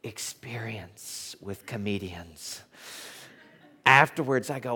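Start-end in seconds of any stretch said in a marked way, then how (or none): 0.0-2.6 [name]. Retold or imitated. Club owner, as imitated, answers his catering manager, slow and soft. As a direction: imitate a deliberate speaker, quietly drawn out. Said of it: "And he said".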